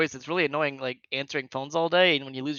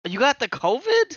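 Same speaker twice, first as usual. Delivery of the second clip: high, loud, slow astonished echo; mock surprise; 9 semitones higher, 7 dB louder, slower than usual. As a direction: act astonished at the news.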